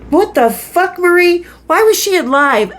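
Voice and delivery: high, startled outburst; surprised. Real, not acted. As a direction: caught off guard by the pass. Finds Marie's surprising pass.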